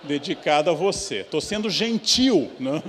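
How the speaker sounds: loud, high indignant protest; indignant outburst. Acted. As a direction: huff at the ungrateful student, loud and high. Indignant retold thought.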